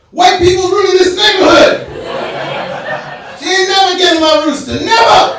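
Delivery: high, loud shouting with laughter; outraged shout. Retold or imitated. Blanco refusing to give up his rooster, shouting. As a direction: yell it like an outraged neighbor. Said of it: "He's like".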